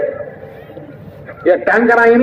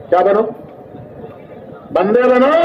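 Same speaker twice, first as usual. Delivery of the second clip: ordinary delivery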